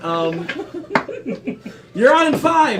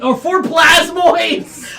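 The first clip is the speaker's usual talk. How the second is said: laughing shout; 8 semitones higher, 5 dB louder, much slower than usual. Real, not acted.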